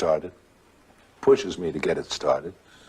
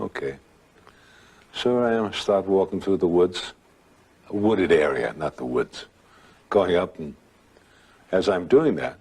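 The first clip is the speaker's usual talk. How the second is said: ordinary delivery, nothing marked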